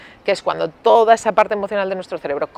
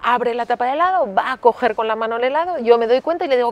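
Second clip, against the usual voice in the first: usual speaking voice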